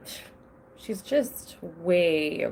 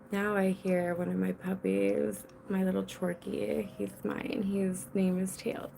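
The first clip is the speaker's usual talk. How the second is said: ordinary talk, nothing marked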